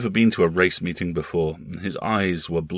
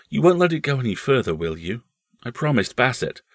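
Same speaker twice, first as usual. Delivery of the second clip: put-on higher-pitched pleading child voice; earnest small boy; 6 semitones higher, 3 dB louder than usual. Boy asking his uncle to keep a secret, earnest. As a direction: plead like an anxious young nephew.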